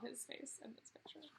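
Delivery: hushed delighted aside; whispered aside. Real, not acted. Teacher whispers to kids about the picture, hushed. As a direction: whisper it as a little secret.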